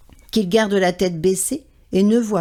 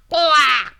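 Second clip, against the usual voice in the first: loud higher croaking caw; crow caw; 6 semitones higher, 4 dB louder than usual. A narrator's reading. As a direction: caw to warn the animals. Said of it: "en faisant des grands «Kouah»".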